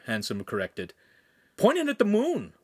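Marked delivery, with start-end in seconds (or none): 1.6-2.5 high, bright-eyed eager call; can't wait to look through it; eager boy urging the master; enthusiastic. A narrator's reading. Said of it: "Lincoln said enthusiastically".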